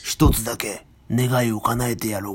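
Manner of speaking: slow, low dragon voice granting a wish; Shenron voice. Pretend. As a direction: speak slowly as the mighty wish dragon. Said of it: "上手、上手".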